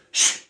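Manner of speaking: short, sharp "shh"; shushing. Retold or imitated. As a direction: imitate a quick shush. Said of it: "and he goes".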